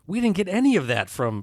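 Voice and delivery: loud, high-pitched audience reaction; imitated astonishment. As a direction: voice a stunned crowd, high and loud.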